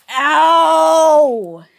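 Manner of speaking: long, loud "ow"; cry of pain. Acted. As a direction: yelp out a drawn-out pain cry. Figure gets hurt.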